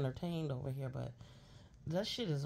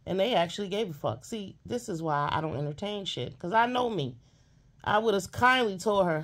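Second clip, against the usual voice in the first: loud rant; 3 semitones higher, 7 dB louder than usual. Real, not acted. Ranting, voice rising.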